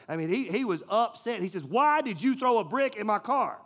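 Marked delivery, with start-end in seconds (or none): 1.6-3.6 loud, high angry demand. Retold or imitated. Player confronting the boy about the brick, loud demand. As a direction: push him hard for an answer.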